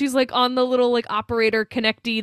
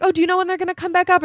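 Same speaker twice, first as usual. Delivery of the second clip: quick, higher-pitched retold question; lightly voiced Wendy; 9 semitones higher, faster than usual. Wendy asking the ranger about phone lines, lightly imitated quick high voice. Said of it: "And she's like".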